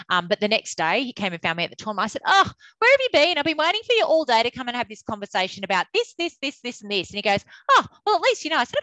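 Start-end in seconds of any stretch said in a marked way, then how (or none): 2.2-7.1 high, loud teasing reproach; mock scolding. Manager cheekily scolding the coach who avoided her, mock reproach. 7.7-8.6 high, loud sheepish reply; imitating the coach. Coach sheepishly answering the umpires manager, high mock reply.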